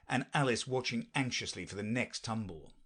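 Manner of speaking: ordinary delivery, nothing marked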